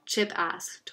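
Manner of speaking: usual speaking voice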